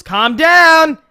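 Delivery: loud, high mock mom; mom yelling. Imitating his mom telling him to calm down. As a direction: bark it like a mom through the door. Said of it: "My mom goes".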